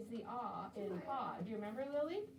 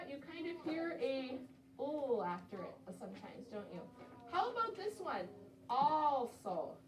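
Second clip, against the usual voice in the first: ordinary talk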